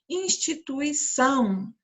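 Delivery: "Instituição" devagar, com ÇÃO mais forte; ênfase na última sílaba. Shown as a demonstration. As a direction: pronuncie "Instituição" pausadamente, carregando no ÇÃO final. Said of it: "são é a sílaba mais forte". ("Instituição" silabado, destacando o ÇÃO)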